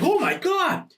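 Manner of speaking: high-pitched exaggerated reaction; hammed-up shocked complaint; mock astonishment. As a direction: exclaim it, appalled at the taste. Acted drinker tasting miracle wine.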